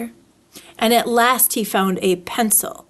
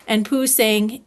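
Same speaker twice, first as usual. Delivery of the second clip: usual speaking voice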